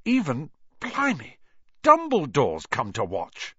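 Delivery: high amazed exclamation. Acted. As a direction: gasp in disbelief. Fred amazed at Dumbledore.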